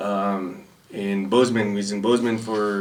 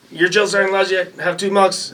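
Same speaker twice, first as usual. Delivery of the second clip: drill sergeant voice; 8 semitones higher than usual. Retold or imitated. Other battery's drill sergeant questioning a recruit, high mock bark. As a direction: grill him like a drill sergeant.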